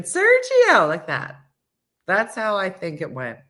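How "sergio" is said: high loud scream of a name; hammed-up high yell; mock scream. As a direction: scream his name while falling. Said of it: "and screaming".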